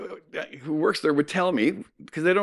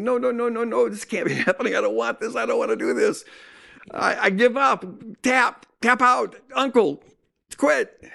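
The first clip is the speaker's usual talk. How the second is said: despairing; 6 semitones higher than usual. Retold or imitated. Despairing inner voice giving up.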